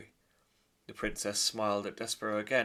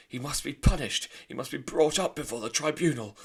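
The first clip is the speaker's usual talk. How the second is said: much louder, stern and pounding; furious father; 6 semitones higher, 10 dB louder than usual. Acted. Lester demanding punishment, loud and furious. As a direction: hammer each word in anger. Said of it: "shouted Lester".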